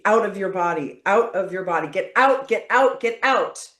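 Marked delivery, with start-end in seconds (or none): 1.9-3.7 high, loud repeated get out; forceful shouting. Shown as a demonstration. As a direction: shove the words out. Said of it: "demo of getting energy out".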